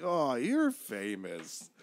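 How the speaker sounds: very drawn-out echo; mock awe. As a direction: stretch the words out in mock wonder. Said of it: "And I'm like".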